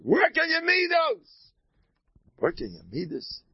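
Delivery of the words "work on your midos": high, slow preachy tone; much higher sing-song sermonizing; mock sage advice. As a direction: ham up the sanctimonious mentor. Mock pious advice, then repeated plainly.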